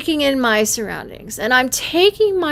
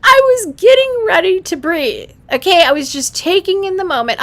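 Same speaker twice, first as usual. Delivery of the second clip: worked up; 9 semitones higher, 6 dB louder than usual. Real, not acted. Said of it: "so traumatized".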